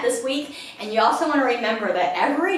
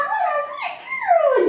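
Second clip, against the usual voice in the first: very high, gushing praise for the dog; excited dog praise; slower than usual. Real, not acted. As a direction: gush over her like she's the best. Praising dog after recall.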